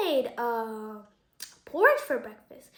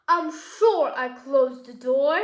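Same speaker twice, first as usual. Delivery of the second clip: loud, slow storybook papa bear; big slow bear voice for a children's story; Daddy Bear; 7 dB louder, slower than usual. A narrator's reading. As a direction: announce it slowly and loudly as the father bear. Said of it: "said Daddy Bear".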